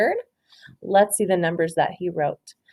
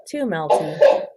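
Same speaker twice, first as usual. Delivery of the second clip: ordinary delivery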